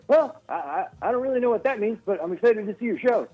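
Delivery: high, stammering, breathless host impression; puzzled but eager, pitched well up; Jimmy Fallon. Host replying to a baffled comedian, breathless impression. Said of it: "And he's like".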